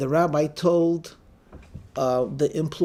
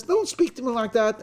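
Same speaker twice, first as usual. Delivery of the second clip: acted irate; 7 semitones higher than usual. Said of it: "seems very irate and says".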